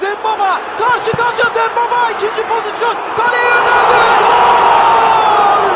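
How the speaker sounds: building to a very loud goal scream; screaming football commentator. Real, not acted.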